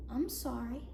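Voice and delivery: soft, subdued sorry; meek apology. Acted. Woman saying what she feels she ought to, meekly. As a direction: shrink into a small dutiful apology.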